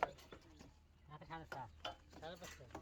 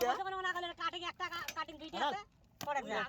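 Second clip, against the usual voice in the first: high, loud shouted instructions; loud ordering; 11 semitones higher, 6 dB louder than usual. Real, not acted. Worker shouting instructions to the crew, loud and high. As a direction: call it out high over the work site.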